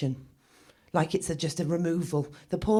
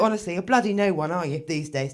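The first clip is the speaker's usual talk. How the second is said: usual speaking voice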